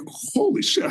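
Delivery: high, airy mock exclamation; stunned, high-pitched retelling; retold stunned disbelief. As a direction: replay the shock in a high, breathy gasp of words. Stranded, learns of bus strike.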